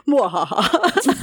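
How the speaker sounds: slow mock evil cackle; sneering panto villain. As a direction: gloat like a cartoon villain. Gloating over a contingency plan.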